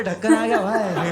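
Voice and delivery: slow, loud, put-on voice; lightly hammed-up friend; mock friend voice. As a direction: drag it out loudly like the buddy. Friend's line acted in story.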